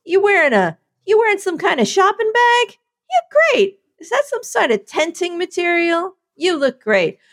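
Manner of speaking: mock the outfit, then fake-praise it; mock catty critic.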